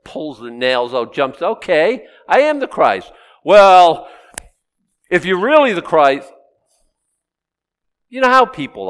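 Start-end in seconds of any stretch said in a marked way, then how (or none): 1.4-3.1 high, loud mock announcement; hammed-up loud proclamation. Imagined Christ jumping down. 3.4-6.3 loud, high skeptical retort; loud mocking skeptic; mock doubter. Doubter still refusing.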